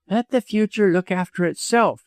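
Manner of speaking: high-pitched, impatient retort; petulant. A narrator's reading. Widow waves away doctor's hopes, petulant. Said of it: "she cried petulantly".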